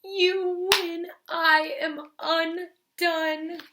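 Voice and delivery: slow, high, tearful surrender; high, slow mock blubbering. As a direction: drag out a defeated wail. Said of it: "he blubbered".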